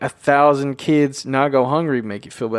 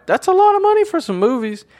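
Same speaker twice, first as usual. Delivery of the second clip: high held squeak of awe, much higher; amazed; 13 semitones higher than usual. Real, not acted. Podcaster awed by box office totals, high squeal. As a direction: marvel at the money in a squeal. Marvels at movie earnings.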